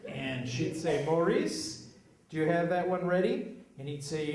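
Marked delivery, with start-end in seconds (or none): 1.0-3.6 higher and louder call across the station; impatient call to Maurice; mock calling out; customer calling out.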